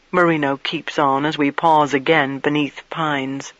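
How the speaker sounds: ordinary delivery, nothing marked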